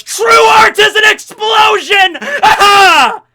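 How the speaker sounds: huge high-pitched drawn-out yell; over-the-top shouted catchphrase. Acted. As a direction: belt it out like an anime battle cry.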